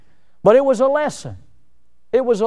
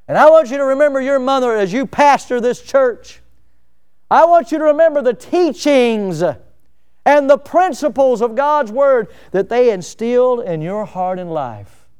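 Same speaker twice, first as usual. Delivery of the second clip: higher and louder, leaning hard on "want"; impassioned pulpit charge; 6 semitones higher, 5 dB louder than usual. Acted. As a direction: press the charge home to the pastor. Paul's charge preached at full force.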